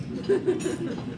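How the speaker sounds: a breathy high-pitched laugh. Real, not acted. Laughing at the stranger's idea, high breathy laugh. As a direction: laugh it off, amused.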